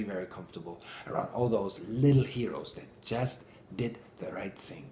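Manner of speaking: normal talking voice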